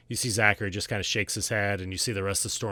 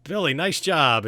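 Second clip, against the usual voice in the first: loud, higher, slow announcer-like praise; hearty congratulation; 6 semitones higher, 7 dB louder, slower than usual. Acted. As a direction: proclaim the winner to the crowd. Judge congratulates winner aloud.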